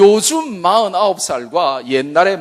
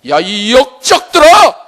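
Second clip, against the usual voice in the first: slow furious shout, much higher and louder; angry rebuke; 10 semitones higher, 9 dB louder than usual. Acted. Preacher roaring at congregation, furious shout. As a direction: roar it down at the traitors.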